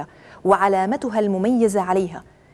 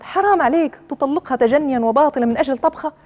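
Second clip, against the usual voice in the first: high-pitched acted outcry of reproach; indignant protest; 5 semitones higher, 3 dB louder than usual. Second wife objects to husband divorcing over a meal, acted indignant protest. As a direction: scold him in a raised, higher voice.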